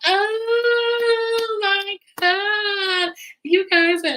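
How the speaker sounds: very high, drawn-out squeal of delight; mock overjoyed gush. Recapper mimicking a friend happy at a reconciliation, exaggerated gushing. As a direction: imitate a delighted friend, stretching each word high. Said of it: "Letitia is just happy. She goes".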